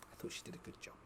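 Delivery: soft breathy aside under the breath; whisper. Real, not acted. Whispering a quiet aside to chat about Sheila. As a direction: whisper the praise as a private aside.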